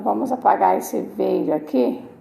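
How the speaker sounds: usual speaking voice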